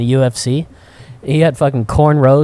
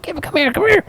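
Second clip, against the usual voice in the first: high coaxing call; 14 semitones higher than usual. Retold or imitated. Calling the dog.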